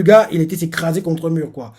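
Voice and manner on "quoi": ordinary delivery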